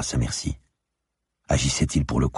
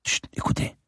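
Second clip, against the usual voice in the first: softer, whispered shush; hushed warning; 4 dB quieter than usual. Acted. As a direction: hush them with a whisper. Hushing companion to listen at door. (chut chuchoté)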